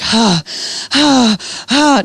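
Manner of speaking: imitated contraction panting; heavy breaths, mimicking a woman in labor; labor breathing. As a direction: act out breathing through a contraction. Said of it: "She was having a contraction".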